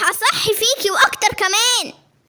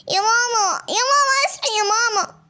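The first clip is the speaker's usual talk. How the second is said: ordinary talk, nothing marked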